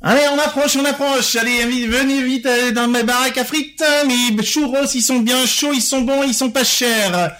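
high, hyped fairground barker; singsong market cry. Shown as a demonstration. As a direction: call out to the crowd like a fair stall. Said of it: "mode fête foraine".

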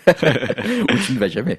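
laughing while teasing; amused, slow, laughing. Real, not acted. Teasing banter between friends.

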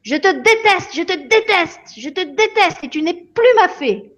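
put-on high pitched furious little girl; child's angry outburst. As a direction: spit it out like a tantrum. Lily rages at fairy.

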